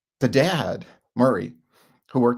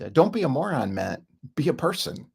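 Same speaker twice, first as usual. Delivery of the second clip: normal talking voice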